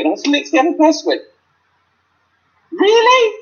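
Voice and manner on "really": high, loud disbelieving question; incredulous. Retold or imitated. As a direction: ask it in total disbelief. Looking at bystander in disbelief.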